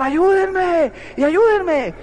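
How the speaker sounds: mock shout for help, dangling from a branch; cry for help. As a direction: call out for help, desperate but acted. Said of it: "usted empieza a gritar".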